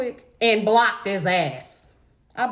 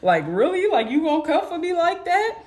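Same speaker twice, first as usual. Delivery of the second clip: incredulous question through a laugh; imitated laughing reply; 9 semitones higher than usual. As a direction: play him laughing as he asks. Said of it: "And he started laughing".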